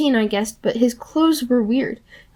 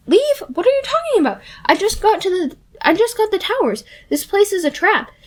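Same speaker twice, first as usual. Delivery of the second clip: put-on a much higher, irritated girl's voice; annoyed Annabeth; 8 semitones higher than usual. Annabeth snapping at Percy for interrupting her game. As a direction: brush him off, she's busy building.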